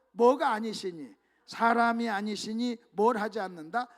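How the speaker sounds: slow, loud call for the answer; slow quiz prompt. Real, not acted. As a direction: draw the answer out of the congregation. Preacher quizzes congregation.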